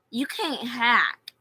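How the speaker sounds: slow, loud mocking comeback; slow loud retort. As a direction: shoot it back, spacing the words.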